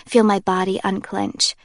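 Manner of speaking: usual speaking voice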